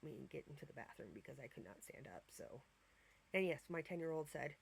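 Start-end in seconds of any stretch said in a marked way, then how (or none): 0.0-2.6 hushed, embarrassed whisper; soft confiding whisper; whispered confession. Real, not acted. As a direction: tell it under your breath. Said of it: "embarrassed confession".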